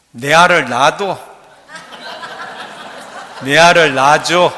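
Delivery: loud, blunt Gyeongsang love line; Gyeongsang dialect. Shown as a demonstration. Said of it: "경상도 사투리는 뭐라고 할까요?". (경상도 사투리로 크게)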